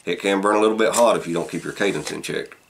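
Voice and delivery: speaking with pipe in mouth; talking around a pipe. Real, not acted. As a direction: pipe clenched in teeth. Seen 0: Pipe stem held in mouth through all labelled speech frames.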